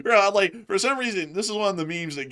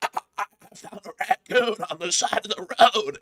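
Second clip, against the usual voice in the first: slow, strained creaky delivery; joking, squeezed-out words; strained joke voice; 4 semitones higher than usual. Pretend. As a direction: draw it out in a put-on strained voice. Odd non sequitur, joke voice.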